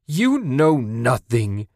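high, loud, slow furious rage; raging. A narrator's reading. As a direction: spit it out in fury. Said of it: "she raged".

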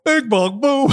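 high, sing-song fan taunt; mocking fan taunt. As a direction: tease him like a street heckler. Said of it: "They'd come up and go".

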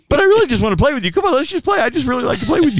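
high, fast whiny dog voice; a dog begging the cat to play, quick and eager; Hank pleading. Acted. Bulldog Hank pleading with the cat, fast high whine. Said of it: "And Hank's just".

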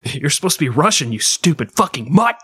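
hammed-up exasperated yell, rising in pitch; mock angry scolding. Director berating a Scottish terrier's accent, mock angry scolding. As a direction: tear into the dog, playfully overdone.

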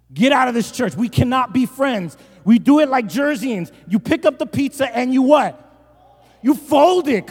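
loud, high preacher's rant; mock outrage. Pastor banishing fork-and-knife pizza eaters, mock outrage. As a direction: thunder it in pretend indignation.

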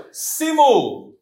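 "Simu" said high and loud for repeating; Latin pronunciation demo. Shown as a demonstration. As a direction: model "Simu" loudly for learners. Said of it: "Fala assim, ó". (demonstração em voz alta de "Simu")

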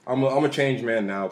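usual speaking voice

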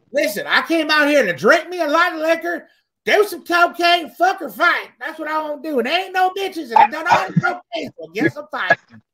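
high, loud, hammed-up rowdy dude; coked-up party guy. Thirties guy high on cocaine wants to fight, rowdy mock rant. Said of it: "guys in their thirties are like".

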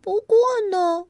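lightly voiced rabbit reading; rabbit reading aloud. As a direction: read the rules like a serious little rabbit. Rabbit reads page seven.

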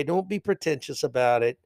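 usual speaking voice

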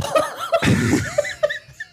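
long, high-pitched burst of laughter. Real, not acted. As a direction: squeal with laughter. Laughing about friend joining call.

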